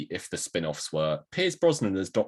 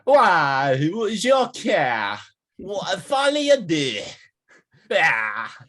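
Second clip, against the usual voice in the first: higher, louder theatrical Joker impression; hammed-up villain voice with a French twist; Jared Leto Joker; 11 semitones higher, 6 dB louder, much slower than usual. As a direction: camp it up like a movie villain Joker. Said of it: "it's Jared Leto. How do you say it?".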